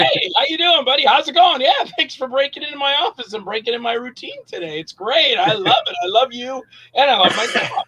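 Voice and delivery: overly delighted boss greeting the intruder; happy boss. Retold or imitated. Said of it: "You're happy".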